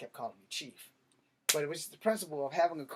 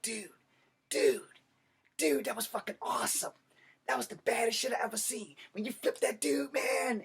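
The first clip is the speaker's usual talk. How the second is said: high, excited dude-bro gushing; mock awestruck coworker; impressed coworker; 8 semitones higher than usual. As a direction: gush like a starstruck buddy. Coworker gushing over the fight.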